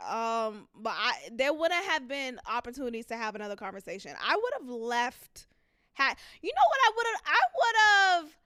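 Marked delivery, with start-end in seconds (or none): 5.9-8.4 excited. Real, not acted. Excited laughing.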